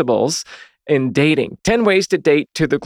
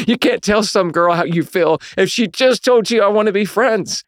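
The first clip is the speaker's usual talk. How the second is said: laughing through it, higher up; 6 semitones higher than usual. Real, not acted. Laughing at his younger self to the congregation, higher pitched. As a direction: chuckle while making the point.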